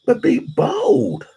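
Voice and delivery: normal talking voice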